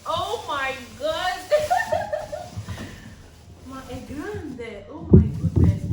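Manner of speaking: squealing laugh, high and loud; laugh-scream in pure delight; shrieking jackpot winner. Real, not acted.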